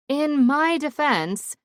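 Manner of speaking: high, loud, drawn-out protest; defensive cut-in. A narrator's reading. Daughter cutting in on her parents' stories, defensive. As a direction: cut in loudly and slowly to defend yourself. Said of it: "I finally cut in".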